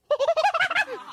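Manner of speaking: high, loud blowing monkey squeal; monkey hostility noise. Retold or imitated. Mimicking an angry little monkey at another monkey with a high squeal. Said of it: "he goes".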